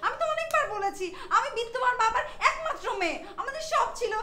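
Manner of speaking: high-pitched, upset protest; worked-up, pleading high voice; distraught insistence. Acted. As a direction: insist desperately that it was all real. Insisting her lost wealth was real.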